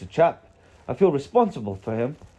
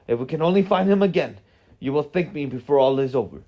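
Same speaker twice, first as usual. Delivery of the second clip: raised, emphatic scolding in character; heated storybook rebuke, higher and forceful; angry wizard; 4 semitones higher, 5 dB louder than usual. A narrator's reading. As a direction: tell them off as an indignant wizard. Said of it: "Gandalf answered angrily".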